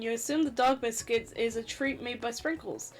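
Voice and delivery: ordinary delivery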